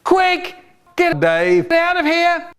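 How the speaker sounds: very high, frantic scream; shrieked storybook panic; screamed order. Acted. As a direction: scream it urgently, right at the top of the voice. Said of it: "She screamed".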